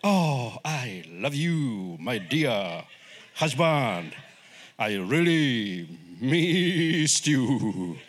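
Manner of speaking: low, very slow, drawn-out declaration; mock professional affection. Wife to returning husband, mock-professional and unexcited. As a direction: stretch each word out with joyless formality. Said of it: "she's happy?".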